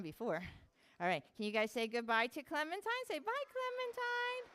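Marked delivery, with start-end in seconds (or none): 3.1-4.4 squeaky animal voice. Pretend. Voicing the animal's goodbye.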